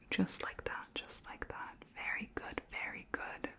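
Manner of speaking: barely voiced, repeated praise; whispered reassurance. Alien examiner checking under a human's tongue, whispered reassurance. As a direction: whisper it while absorbed in the exam.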